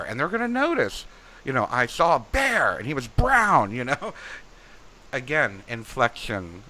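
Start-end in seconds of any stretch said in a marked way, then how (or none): 1.7-3.7 1 excited dream reading. Shown as a demonstration. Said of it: "you're all excited about the word bear".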